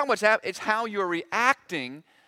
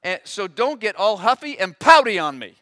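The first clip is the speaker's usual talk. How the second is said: louder, scolding rebuke; stern God; 9 dB louder than usual. Retold or imitated. God rebukes huffy Job, louder. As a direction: put him in his place. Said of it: "God proceeds to tell him".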